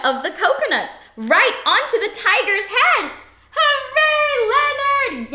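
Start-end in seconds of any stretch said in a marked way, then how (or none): 3.6-5.3 cheer. Pretend. Cheering the character.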